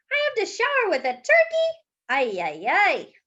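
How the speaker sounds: high, loud cry of disbelief; dismayed outburst. Acted. Grandpa protests sharing his shower, high and shocked.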